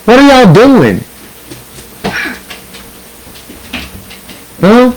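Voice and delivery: high exasperated call to the dogs. Real, not acted. Owner yelling at his dogs to stop chasing.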